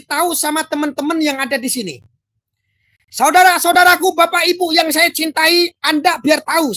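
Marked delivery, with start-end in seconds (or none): 3.1-6.7 high, loud preacher-style opening; orator's address. Acted. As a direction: proclaim it to the whole congregation. Addressing the audience grandly. (seruan lantang ala penceramah)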